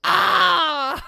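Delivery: loud high shriek with a sob; squeal of delight. Real, not acted. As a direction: shriek as if it's too much to bear. Overwhelmed by cute scene.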